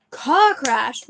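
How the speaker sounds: a loud bellowed "Car crash!"; roar. Acted. Hagrid roars at the Dursleys in outrage. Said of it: "roared Hagrid".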